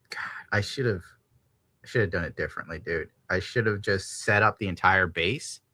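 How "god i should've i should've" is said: soft whisper to himself; whispered regret. Real, not acted. Streamer regretting the broken statue repair, whispering. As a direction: breathe it out in regret. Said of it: "whispered regret".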